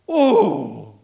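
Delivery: sudden loud awed oh; a gasp of wonder. Acted. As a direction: let out an amazed loud oh. Awed exclamation after the verse.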